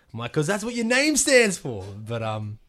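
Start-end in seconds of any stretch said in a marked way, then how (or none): 0.3-1.8 drawn-out, high, loud mock exasperation; exasperated retort. Speaker retells answering Sharon about the name, exasperated. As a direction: spell out the obvious, loud and high, stretching it.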